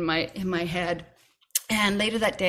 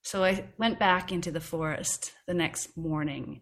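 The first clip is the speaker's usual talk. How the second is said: ordinary delivery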